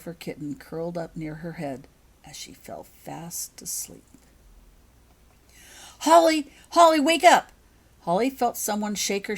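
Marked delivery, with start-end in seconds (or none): 2.3-4.0 fading whisper. A narrator's reading. Narrator lulling the listener as Holly falls asleep, whispered. 6.0-7.5 loud, high wake-up call; urgent call. Acted. Becky calling her sleeping friend awake, loud and urgent.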